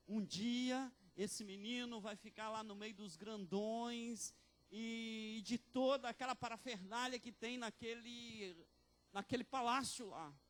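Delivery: slow, rising to loud proclamation; mother's vow swelling into a sermon shout; building preaching. Acted. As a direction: build from firm to triumphant.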